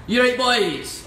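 loud, high Scottish greeting; Scottish accent. Shown as a demonstration. Singer greeting the boys on request, hammed-up Scottish accent. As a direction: call out in a put-on Scottish accent. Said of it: "Scottish accent".

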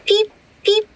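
high bright peep like a little whistle; train whistle peep. A narrator's reading. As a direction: toot it high like a toy engine. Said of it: "goes Thomas".

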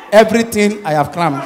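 usual speaking voice

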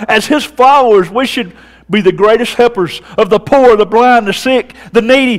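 loud, high Southern preacher's build; preaching at full force. Acted. Pastor urging his congregation, loud and rising. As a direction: drive it home to the congregation.

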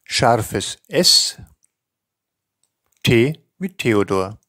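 each letter and name spoken slowly and distinctly with pauses; careful spelling dictation. Shown as a demonstration. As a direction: dictate it clearly, like spelling over the phone. Teacher reads Austrian alphabet.

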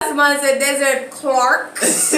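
laughing. Real, not acted. Laughing at friend's attempt.

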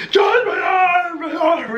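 high, drawn-out mock panic cry; hammed-up squeal of alarm, stretched out; panicked brother impression. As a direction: wail it high like a terrified kid. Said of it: "he goes".

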